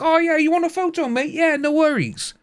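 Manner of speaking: high mocking fake-nice reply; mock cheery celebrity. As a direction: send up a star saying yes to a fan.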